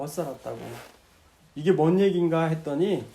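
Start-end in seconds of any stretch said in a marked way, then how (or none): 0.0-0.6 much softer, lower trailing echo. Retold or imitated. Deacon repeating his regret, fading into a quiet murmur. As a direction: let the regret sink to a murmur. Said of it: "자기 가슴을 자꾸 치더래요".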